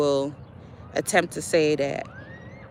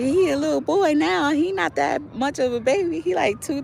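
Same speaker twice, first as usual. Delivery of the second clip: sheepish excuse; 9 semitones higher than usual. Retold or imitated. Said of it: "And I was like".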